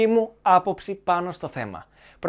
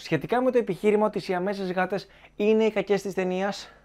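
ordinary delivery

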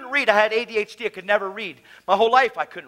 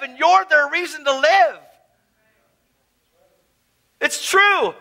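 higher, louder preacher's call; preaching; 8 semitones higher, 5 dB louder than usual. Acted. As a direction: drive it home to the crowd. Preaches at full force.

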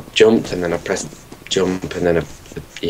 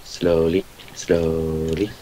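low, drawn-out, fading softer; careful coaxing; 5 semitones lower, 6 dB quieter, much slower than usual. Pretend. Player guiding a careful move, slowly and quietly. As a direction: ease each word out lower and softer.

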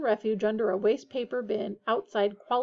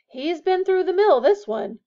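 higher, louder knowing witch; 8 semitones higher, 9 dB louder than usual. A narrator's reading. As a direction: tut over the battered rat. Witch voiced in the story.

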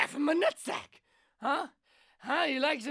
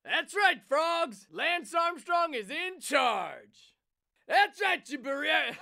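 mock rant; 2 semitones higher, 3 dB louder, slower than usual. Ranting as Super President character.

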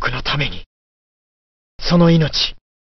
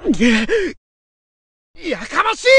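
irritated outburst; 12 semitones higher than usual. Acted. Character snaps at the cursed sword.

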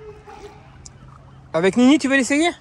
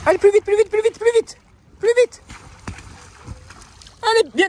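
excited cheering. Real, not acted. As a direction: shriek encouragement to speed up. Urging hamster to go faster.

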